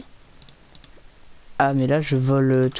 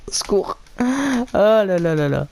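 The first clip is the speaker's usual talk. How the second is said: panicked laughter; 5 semitones higher, slower than usual. Real, not acted. Chased by bees, laughing.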